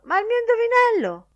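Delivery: high, loud, quick squeal of protest; indignant high-pitched outburst. A narrator's reading. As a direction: protest shrilly at being passed over. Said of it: "tese le mani in segno di protesta".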